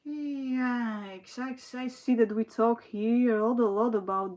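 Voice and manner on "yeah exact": ordinary talk, nothing marked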